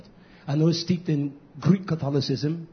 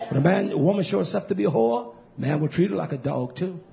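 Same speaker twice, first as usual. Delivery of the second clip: normal talking voice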